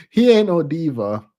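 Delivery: normal talking voice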